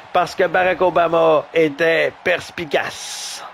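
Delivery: usual speaking voice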